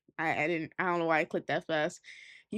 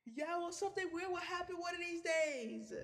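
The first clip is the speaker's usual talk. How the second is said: higher, softer, laid-back friend voice; Austin's voice; 10 semitones higher, 9 dB quieter than usual. Acted. Austin talking to his friend about finding aliens tomorrow, higher and softer. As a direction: tease your friend gently from the curb.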